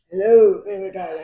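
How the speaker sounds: a hint of the narrator's deep Reese voice; mock deep narrator voice. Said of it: "he just gets really deep with it".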